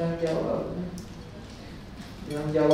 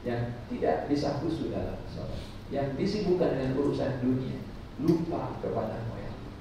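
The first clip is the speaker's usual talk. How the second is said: soft, lower, humble prayer; humble heartfelt prayer; 4 semitones lower, 7 dB quieter than usual. Shown as a demonstration. Ustaz showing pilgrims how to feel the talbiyah, soft prayer. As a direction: pray it softly, ashamed before God. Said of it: "Coba rasakan".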